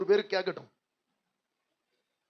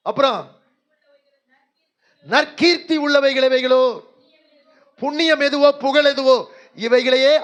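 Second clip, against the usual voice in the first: full-force preaching; 4 semitones higher, 13 dB louder than usual. Acted. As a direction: thunder each line out to the congregation. Loud verse preaching.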